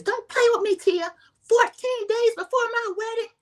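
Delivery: very high mock yell; shrieky, high-pitched mock warning; retold yelling. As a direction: imitate a furious bride at the top of the range. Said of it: "I don't know why she's yelling".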